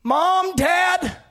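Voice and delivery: high, loud scream of a frightened son; child screaming. Acted. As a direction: shriek it out as a scared child. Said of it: "screaming in my ear".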